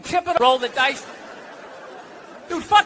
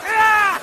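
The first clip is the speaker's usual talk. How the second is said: short high yelp of attack; mock scream; 7 semitones higher, 3 dB louder than usual. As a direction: yelp as if bitten. Dog attacks him.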